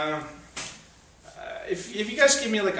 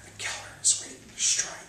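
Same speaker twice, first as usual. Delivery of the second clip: eerie whisper. Acted. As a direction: lean in and whisper the threat.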